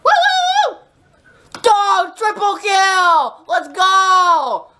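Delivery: hyped victory cheer; excited shout. Real, not acted. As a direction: whoop and yell the win. Celebrating a triple kill.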